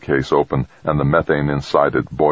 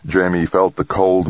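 plain reading voice

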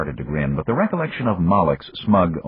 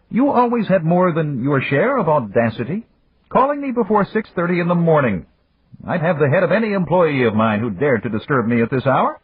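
higher, unhurried voice of an amused magnate; Yamagata; 12 semitones higher, 4 dB louder than usual. A narrator's reading. Yamagata chiding Dan for the early call, higher and amused. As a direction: tease an old friend from a position of power.

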